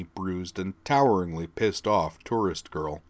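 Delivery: ordinary delivery, nothing marked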